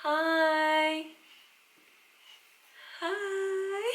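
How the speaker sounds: sweet high cooing greeting. Real, not acted. Greeting baby in the mirror.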